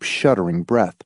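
plain reading voice

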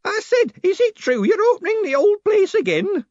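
very high, loud busybody woman; insistent woman. A narrator's reading. Woman tugging at his sleeve, repeating herself insistently. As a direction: repeat it shrilly, demanding an answer. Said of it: "she repeated".

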